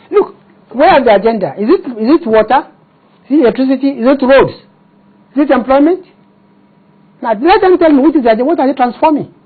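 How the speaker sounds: high-pitched, insistent rhetorical questions; pressing, incredulous demand; exasperated challenge. Real, not acted. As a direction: fire off each question as a challenge. Challenges interviewer with rapid questions.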